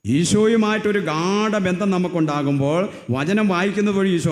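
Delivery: loud, high preacher's surge; preaching at full force. Acted. Preacher to the congregation, loud and impassioned. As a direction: lean hard into the message. Sermon climax.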